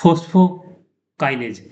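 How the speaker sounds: "phosphokinase" very slowly, split as phospho, pause, kinase; name broken up. Shown as a demonstration. Teacher shows the clue in the name, "phosphokinase" slowly split in two. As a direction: stretch "phosphokinase" into two parts with a gap.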